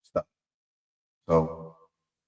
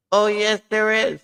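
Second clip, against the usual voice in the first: high, loud little frog insisting; insistent frog; 13 semitones higher, 4 dB louder than usual. Acted. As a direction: squeak back like a stubborn frog.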